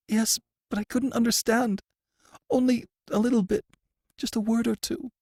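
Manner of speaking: high, shaken voice; a prisoner answering the warden, high and frightened. A narrator's reading. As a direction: answer timidly, still scared.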